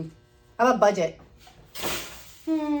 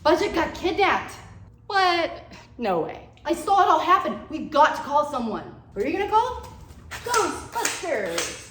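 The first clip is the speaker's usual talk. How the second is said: panicking; 5 semitones higher than usual. Acted. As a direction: blurt it out in a panic. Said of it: "Dude, you're panicking".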